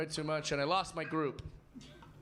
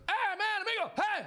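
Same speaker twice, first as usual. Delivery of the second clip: put-on high-pitched Mexican-accented yelling; yelling Mexican guy; 3 dB louder than usual. Comic retells a beachgoer scolding him, yelled in Mexican accent. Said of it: "this Mexican guy starts yelling at me".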